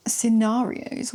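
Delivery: slow, posh drawn-out scenarios; a posh "Scenarios?" with a long rising end. Real, not acted. Tarot reader to viewer, posh "Scenarios?". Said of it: "Why did I sound so posh saying that?".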